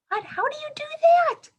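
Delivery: high, loud astonished squeal; amazed disbelief. Acted. Teacher to class about half-brain sleep, astonished high squeal. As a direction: gasp at it like it's impossible.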